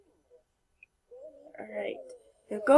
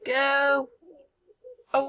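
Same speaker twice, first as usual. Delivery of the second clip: ordinary delivery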